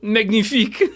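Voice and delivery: a hammed-up French magnifique; French accent. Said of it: "say with a French accent".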